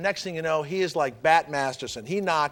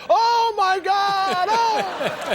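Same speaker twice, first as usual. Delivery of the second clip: high, drawn-out mock cry of terror; imitating nightmare cry; 14 semitones higher, 3 dB louder, much slower than usual. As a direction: replay the father's nightly panic, stretched and high. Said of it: "we'd hear him in the bed every night and go".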